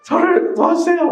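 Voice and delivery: very high, loud begging cry; a man imitating his own desperate prayer, crying out high; desperate pleading. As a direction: plead like a day laborer begging to be hired. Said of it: "그런 절실함으로".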